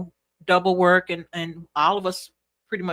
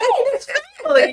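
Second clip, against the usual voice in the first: excited; 4 dB louder, much faster than usual. Retold or imitated. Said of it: "It was kinda like".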